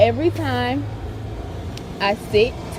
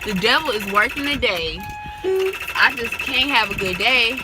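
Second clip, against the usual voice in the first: normal talking voice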